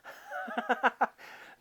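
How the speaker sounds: high, airy run of laughter; real laugh. Real, not acted. Host amused by a dark lyric about the bar, laughing. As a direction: break into an amused giggle.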